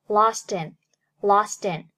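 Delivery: lost in, the t linked straight into in. Shown as a demonstration. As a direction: say lostin as one word. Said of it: "Lost和in连读". (连读lost in)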